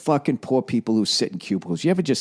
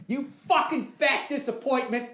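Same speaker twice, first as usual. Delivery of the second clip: high, hissed insult to his own reflection; self-scolding; 11 semitones higher, 7 dB quieter than usual. Acted. Man scolding himself in the mirror, hissed insult. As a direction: sneer it at yourself in the mirror. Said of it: "I gotta talk to myself in the mirror".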